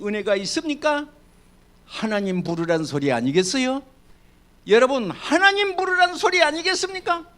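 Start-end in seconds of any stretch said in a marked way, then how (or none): 4.7-7.3 하나님 부르란 소리 higher and louder than the first take; raised preaching call. Acted. Pastor to congregation, higher and louder second take of 하나님 부르란 소리. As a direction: lift the whole question up high.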